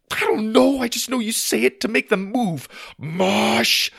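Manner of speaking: high, loud, frantic outburst; panic, ending in a shouted command; freaking out. Acted. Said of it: "start to freak out".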